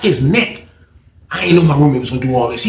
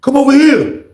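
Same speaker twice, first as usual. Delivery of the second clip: calling out; 11 semitones higher, 4 dB louder than usual. Retold or imitated. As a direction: call someone over from a distance, pitched high. Retold man calling him over.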